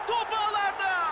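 high, loud goal cry; screaming goal call. Acted. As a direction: shout it as the ball hits the net. Goal call in game commentary. (bağırarak gol anonsu)

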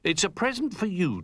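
proud offer. Acted. Bramwell giving a gift.

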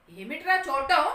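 acted fatherly anger, loud and high; angry father. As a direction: snap at the son in anger. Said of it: "అన్నాడు తండ్రి కోపంతో".

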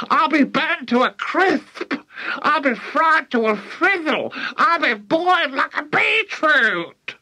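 very high, wailing screech; yelling. A narrator's reading. Grandma lamenting she is burning up, yelling. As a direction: screech each complaint, very high. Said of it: "she yelled".

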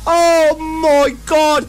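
excited mock commentator, bursting into shouts on the goals; running goal commentary. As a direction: call each goal like a hyped-up match commentator. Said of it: "I am gonna give you a running commentary".

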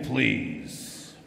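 normal talking voice

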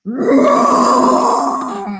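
loud, drawn-out growly lion roar. Shown as a demonstration. Librarian showing children how a lion roars, loud roar. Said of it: "Can you roar like a lion?".

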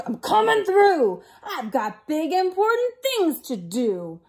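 big bossy truck voice, loudly acted; yelled, pushy dump truck. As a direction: barge through as a rude, self-important truck. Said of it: "yelled a dumb truck".

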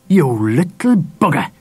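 slow, indignant scolding; angry. A narrator's reading. Dad rounds on his missing son, angry. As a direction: scold him slowly and angrily. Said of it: "he said angrily".

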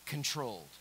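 ordinary talk

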